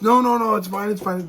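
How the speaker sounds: imitating someone waving away an apology; acted hasty reassurance. Acted hurried reassurance in reply.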